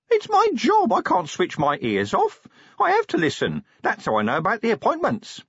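high, squeaky imp defending itself; protesting imp. Acted. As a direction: protest as an indignant little imp. Narrator voices the protesting imp.

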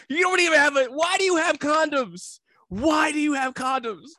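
hammed-up betrayal at his friend; outraged. Outraged at homegirl having condoms.

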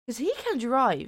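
ordinary delivery

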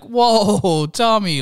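a long, high "whoa" of mock shock; shocked protest. Mobsters react to their friend shooting the kid, shocked protest.

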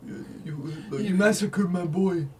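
slow, drawn-out mock mobster menace; lightly voiced Italian mobster; Italian mobster impression. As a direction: ham up a movie mafia boss. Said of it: "the Italian mobsters, where they're like".

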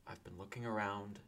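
quiet words to Molly; very quietly. Acted. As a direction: say it quietly so only she hears. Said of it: "very quietly".

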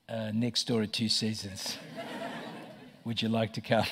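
mildly awkward, low-pitched retold answer; understated, embarrassed invitation, dropped in pitch; sheepish reply. Grumpy pastor invites the attendant to church, mildly sheepish.

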